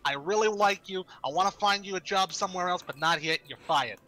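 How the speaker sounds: mock boss firing. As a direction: let them down nicely, then fire them.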